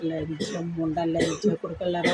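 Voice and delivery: low, slow, spat-out curse; low contemptuous curse. Real, not acted. Vlogger curses her online critics, low and slow. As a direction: drag the insult out low and slow. Cursing commenters in rant.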